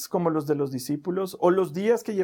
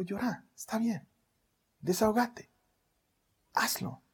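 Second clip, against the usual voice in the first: put-on softer, gentle soothing to a crying child; tender comfort; 5 semitones higher, 4 dB quieter than usual. Father soothing his crying daughter, soft and tender. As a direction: hush her tears kindly, softer.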